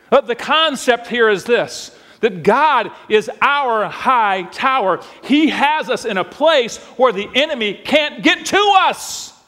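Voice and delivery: slow emphatic preacher's line; preaching. Acted. Preacher explaining God as refuge, preaching. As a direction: lean on God is our high tower.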